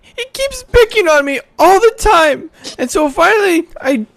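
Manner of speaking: put-on very high, loud child voice; tearful little boy. GM as the elf boy telling the player why he was beaten, upset.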